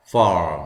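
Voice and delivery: 'farm' with 아 plus a curled r; r-coloured ah. Shown as a demonstration. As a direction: say 'farm' with a long American ar. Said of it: "R이 붙었죠?". ('farm'을 아에 r을 붙여서)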